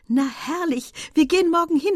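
high, loud, eager agreement; delighted decision. A narrator's reading. Estelle decides on tomorrow's outing, delighted. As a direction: jump at the plan brightly. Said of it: "entschied sich Estelle".

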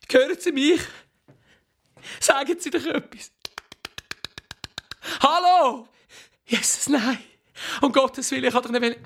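panicked calling. Acted. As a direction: call out in growing panic.

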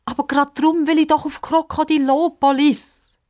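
high, pleading protest; desperate. A narrator's reading. Girl insisting on going to Krokodilopolis, desperate. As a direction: plead urgently, staying high throughout. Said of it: "sagt Pippa verzweifelt".

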